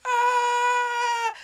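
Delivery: high, loud mock scream while falling. Girl shoved off the building, screaming. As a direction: scream as she's pushed off. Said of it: "and it's like".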